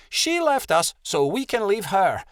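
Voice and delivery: high, cross retort; snapping. A narrator's reading. Rebecca insists on leaving the friend who left, snapping. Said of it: "Rebecca snapped".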